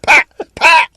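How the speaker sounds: high, loud Cockney yell; Cockney shout. Pretend. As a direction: bellow it like a London landlord. Cockney landlord yelling in pub.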